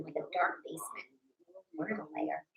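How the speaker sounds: hushed spooky whisper. Pretend. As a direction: breathe it like a secret. Spooky lair talk.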